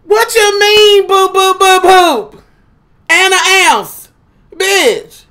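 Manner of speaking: high, loud, slow outraged shout; mock outrage. Arguing with her talking scale, slow high mock outrage. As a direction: yell at the scale in disbelief.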